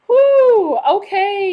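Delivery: loud, high cheer; excited whoop. Real, not acted. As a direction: whoop for the viewers. Cheering live chat arrivals.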